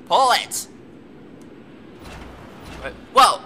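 jolted, cry out as the cart lurches; startled shout. Real, not acted. Gamer yelling as her minecart jolts forward, startled.

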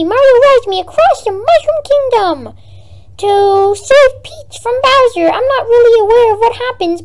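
high, happy cartoon dinosaur; cheerful chirpy Yoshi chatter. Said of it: "here it goes".

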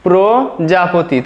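"প্রজাপতি" slowly, with a long first syllable; slow pronunciation. Shown as a demonstration. Teacher introducing the word to students, slow and syllabic. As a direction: draw out "প্রজাপতি" as pro, ja-poti. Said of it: "প্রজাপতি আমরা লিখতে পারি".

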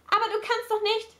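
high-pitched, appalled objection; shocked protest. A narrator's reading. Kate objects to Gabriel taking money, shocked protest. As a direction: protest in dismay, pitched well up.

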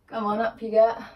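ordinary talk, nothing marked

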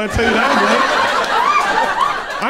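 very slow, high, loud threat; loud warning. Pretend. Comic cheerleader threatening the football team, slow loud warning. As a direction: drag each word out as a threat.

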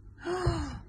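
big storyteller gasp of surprise; dramatic gasp. Acted. Reader gasps as the creature comes out. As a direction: gasp for the children.

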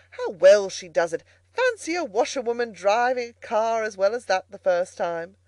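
loud, delighted exclamation; impressed and exclaiming, starting loud; admiring applause. A narrator's reading. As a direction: marvel aloud at her skill. Gentlemen applaud the washerwoman driving.

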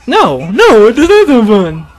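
high, loud outburst of dismay; loud protest. Real, not acted. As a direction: cry out against what the game throws at you. Protesting at the game's attack.

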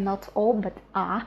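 ordinary talk

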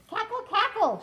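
high cackling hen voice; squawky high hen cackle; clucking hen. Acted. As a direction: cluck it out like a laughing mother hen. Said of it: "she clucked and laughed".

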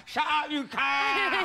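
high, drawn-out Cockney squawk; mum's Cockney. Retold or imitated. Host imitating mum at family Christmas, broad Cockney shout. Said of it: "'Cause my mum's like that".

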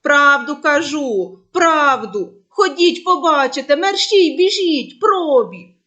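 loud, high, urgent begging; desperate pleading. Acted. Boy begging the shepherds to believe him, loud and desperate.

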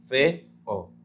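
fe-o split into two syllables; fe-o pulled apart. Shown as a demonstration. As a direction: say fe-o as two separate beats, fe then o. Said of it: "se separa y forma dos sílabas".

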